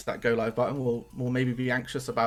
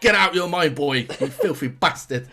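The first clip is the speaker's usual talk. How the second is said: mock scolding; 4 semitones higher, 7 dB louder than usual.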